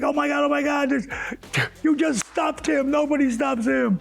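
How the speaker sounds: high-pitched stunned rush of words; imitating excited Big Show. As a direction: gush like you can't believe what you saw. Big Show's excited reaction.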